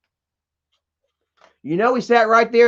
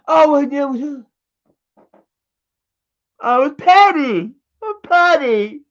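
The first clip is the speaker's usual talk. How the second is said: mock excuse; 5 semitones higher, slower than usual. Mocking Kim's excuse.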